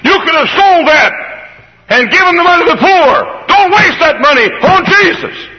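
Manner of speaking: high, loud indignant outburst; Judas protesting. Acted. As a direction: snap it out like a greedy man objecting. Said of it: "suddenly spoke and said".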